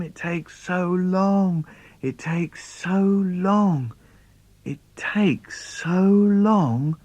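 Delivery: put-on boy laments the long walk to bed; dragged out. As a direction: stretch every word like the walk won't end. Child crossing dark room.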